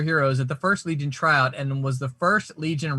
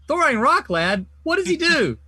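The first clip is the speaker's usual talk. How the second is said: "Throwing Rock Lad?" rising in disbelief, higher voice; can't believe the name, push it up; incredulous question; 11 semitones higher, 7 dB louder than usual. Acted. Parody skit, incredulous hero asks.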